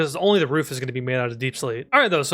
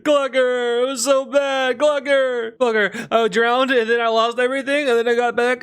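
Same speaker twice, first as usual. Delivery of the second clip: mock wail; 6 semitones higher than usual. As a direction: cry out to the chicken like a tragic loss. Wailing to pet over lost items.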